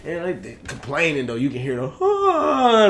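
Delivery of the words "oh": crowd groan. Retold or imitated.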